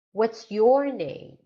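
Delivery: your lifted loud and slow, name falling; falling question intonation. Shown as a demonstration. Teacher modelling WH questions, falling tune on name. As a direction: swing up on your, drop on name. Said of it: "W H questions following".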